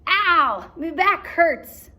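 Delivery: high, loud, slow moan; pirate grumbling about his back; complaining pirate. Acted. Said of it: "Stinky cried".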